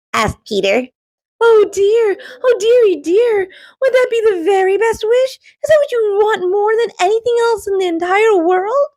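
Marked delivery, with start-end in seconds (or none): 1.4-8.9 much higher, worried whimper; a timid helper wringing her hands; fretting genie. A narrator's reading. Rocky frets over wish.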